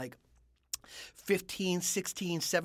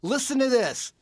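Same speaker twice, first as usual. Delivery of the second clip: raised, louder retold invitation; mildly excited setup; eager announcement; 6 semitones higher, 5 dB louder than usual. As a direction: show off to a friend over the phone. Retelling his own phone dare.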